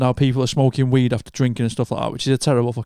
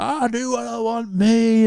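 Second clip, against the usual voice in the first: put-on drunk swagger; 9 semitones higher, slower than usual. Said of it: "You're just like".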